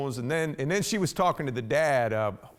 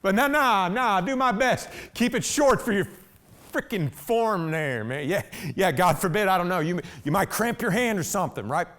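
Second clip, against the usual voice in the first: higher, louder mocking jabs; sarcastic; 5 semitones higher, 3 dB louder than usual. Real, not acted. Man mocking the officer's short form, sarcastic and loud. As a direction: needle him about his short form.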